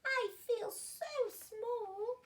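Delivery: high, slow, tearful little voice; small and sad. A narrator's reading. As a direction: feel tiny before the whole world. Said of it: "she said to the whale".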